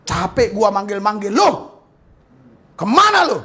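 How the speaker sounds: irritated boss yelling kemana lo; angry master shout. Retold or imitated. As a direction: scold the missing servant. Acted master scolding.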